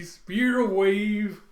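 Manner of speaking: loud, high, stretched-out attack name; called-out attack. Retold or imitated. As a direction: draw the words out in a big anime attack call.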